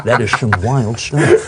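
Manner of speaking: lightly put-on impression voice. Comic reacting to a joke in an impression voice. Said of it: "That was a pretty good impression".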